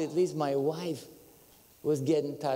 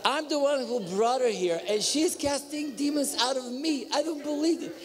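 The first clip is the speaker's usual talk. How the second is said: incredulous; 10 semitones higher than usual. Real, not acted. Incredulous about his wife.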